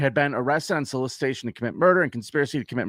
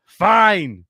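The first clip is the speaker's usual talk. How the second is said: mock exasperated give-in, louder; loud, put-on giving in; exasperated concession; 4 semitones higher, 6 dB louder than usual. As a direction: act out a hitman grudgingly caving, punching each word. Retold grudging give-in of hitman.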